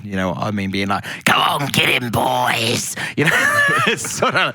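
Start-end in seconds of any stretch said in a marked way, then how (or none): 1.2-2.9 rallying goblin henchman's holler; first boss goblin. Acted. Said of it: "first boss goblin".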